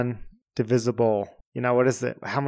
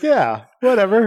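dismissive; 9 semitones higher, 7 dB louder than usual. Retold or imitated. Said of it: "I was like".

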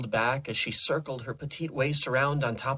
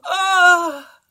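long, loud high moan; high climactic wail; ecstatic cry; 10 dB louder than usual. A narrator's reading. Carmina finishing her climax, crying out. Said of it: "as she cried".

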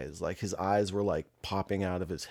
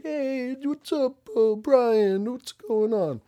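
very high, loud drawn-out hello; hearty group greeting; 6 dB louder, much slower than usual. Retold or imitated. Dispensary staff greeting their regular customer, hearty welcome. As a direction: call out to a regular, pitched way up.

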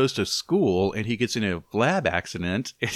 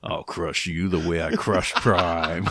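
slow, low villain growl; roaring grunting brute; 5 semitones lower, slower than usual. Retold or imitated. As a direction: menace it out slowly. Quoting Galvatron movie line.

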